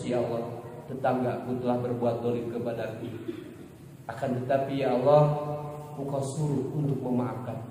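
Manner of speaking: low, hushed plea to God, crying only hinted; tearful prayer. Acted. Praying at night for the neighbour who wronged you, softly tearful. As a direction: plead softly in a subdued acted prayer.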